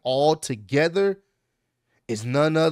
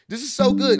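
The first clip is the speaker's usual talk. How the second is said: excited; 8 semitones higher, 5 dB louder than usual. Real, not acted. Fan reacting to a great segment.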